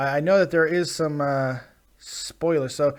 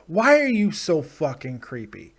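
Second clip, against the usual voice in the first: usual speaking voice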